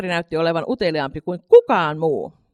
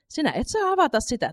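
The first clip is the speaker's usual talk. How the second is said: a mother's firm no, set a little higher; 8 semitones higher than usual. A narrator's reading. Mother repeats to Katri that she must not open it, firm. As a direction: lay down the rule without budging. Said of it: "toisti äiti päättäväisenä".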